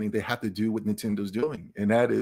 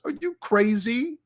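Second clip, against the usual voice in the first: incredulous; 10 semitones higher, slower than usual. Real, not acted. Incredulous at slow pace.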